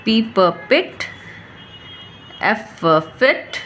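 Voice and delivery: very slow, drawn-out blending of each word; slow phonics drill. Shown as a demonstration. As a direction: sound each word out slowly, like teaching a child. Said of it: "इस तरह से बहुत ही आसानी से बच्चा पढ़ना सीख जाएगा". (बहुत धीमे फोनिक्स अभ्यास)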